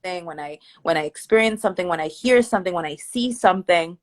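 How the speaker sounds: ordinary delivery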